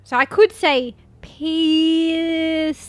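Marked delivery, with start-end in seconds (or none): 1.2-2.8 slow, stretched words with a higher, louder push; slow-mo speech. Pretend. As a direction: say it in deliberate slow motion. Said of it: "I wanna talk in slow-mo".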